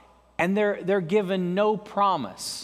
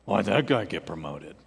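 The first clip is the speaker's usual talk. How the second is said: much lower, muttered complaint; grumbling coworker; 12 semitones lower than usual. Retold or imitated. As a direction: sulk it under your breath like a jealous coworker.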